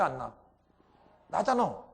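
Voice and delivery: normal talking voice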